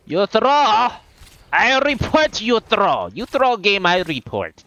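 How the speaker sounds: high, loud yelling in a put-on, vaguely Russian accent; broken-English rant, loud mock Russian accent. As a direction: shout it like an outraged gamer with a hammed-up Russian accent. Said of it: "what accent I'm doing here".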